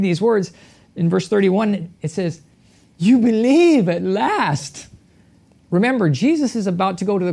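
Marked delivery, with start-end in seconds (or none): 3.0-4.9 slow, knowing, lightly sarcastic; tongue-in-cheek. Retold or imitated. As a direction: say it with a wry raised eyebrow. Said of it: "somewhat sarcastically, tongue-in-cheek".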